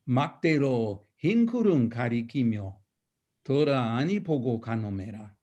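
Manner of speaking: dramatic Korean sijo reading; dramatic poem recitation. Acted. Reciting the last line of a sijo, dramatic.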